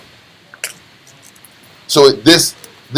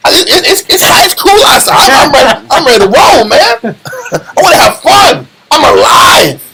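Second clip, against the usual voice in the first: very high, giddy dog voice; a hyped-up dog ready to play; high excited pup, laughing; 9 semitones higher than usual. Pretend.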